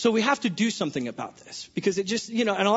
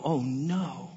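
low, soft mock dismay; a quiet, lower "oh no"; 7 semitones lower, 7 dB quieter than usual. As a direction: sigh it out like bad news just landed. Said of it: "we go".

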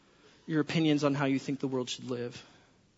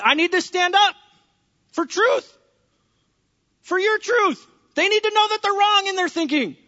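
high, loud, worked-up protest; preacher playing himself pleading with God; frantic rant; 14 semitones higher, 10 dB louder than usual. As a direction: rant like the world is on fire. Acted frantic self arguing with God.

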